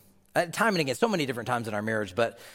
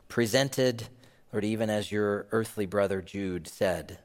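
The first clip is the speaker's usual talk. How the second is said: closing prayer; 4 semitones lower than usual. Acted. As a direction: pray gently over the band. Closing prayer over music.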